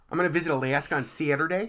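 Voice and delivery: put-on teasing Midwest accent, "Alaska" as "Aliaska" and "Saturday" as "Saa-turday", laughing; mock Midwest accent. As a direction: imitate her old Midwest accent with a laugh. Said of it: "You did have a Midwest Alaska".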